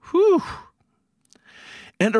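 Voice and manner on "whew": a high, loud whew of relief, let it all out; big sigh of relief. Retold or imitated. Relieved the old lady will be all right, big whew. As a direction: blow out the tension in one loud whew. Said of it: "I breathe a very big sigh of relief".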